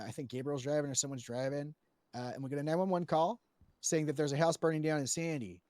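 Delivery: usual speaking voice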